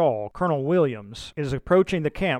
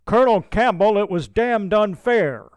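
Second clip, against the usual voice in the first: much louder, higher protest; calling out; 6 semitones higher, 6 dB louder than usual. A narrator's reading. DePaster calls out to Campbell from horseback, loud and indignant. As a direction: call out over the noise in outrage. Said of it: "calls out twice".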